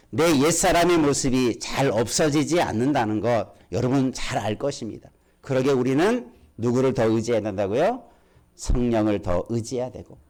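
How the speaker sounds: usual speaking voice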